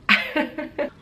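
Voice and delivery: short breathy nervous giggle; embarrassed laugh. Real, not acted. Asked about her first love, an embarrassed airy giggle. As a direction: laugh it off, caught off guard.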